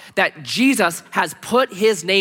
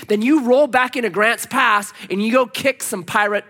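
ordinary talk, nothing marked

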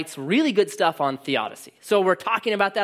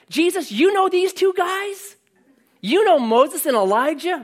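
starstruck Peter; 12 semitones higher, 4 dB louder than usual. Retold or imitated. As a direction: gush at Jesus as if he knows celebrities.

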